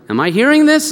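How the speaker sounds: high, incredulous question; astonished. Acted. Brothers hearing the Egyptian speak Hebrew, astonished.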